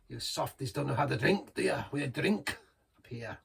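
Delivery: hammed-up Scottish accent; terrible Scottish accent. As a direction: put on a bad Scottish accent, teasing softies. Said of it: "Forgive my terrible Scottish accent".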